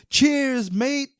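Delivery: hammed-up English accent, tossed off cheerfully. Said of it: "That was my English accent".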